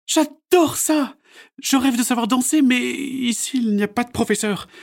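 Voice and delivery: put-on childlike and a bit downcast; a touch of dejection. A narrator's reading. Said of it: "dit Mélanie d'un air abattu". (voix de fillette un peu abattue)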